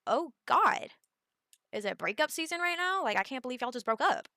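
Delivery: high and loud exclamation of surprise; shocked disbelief. Retold or imitated. Reacting to news of breakup.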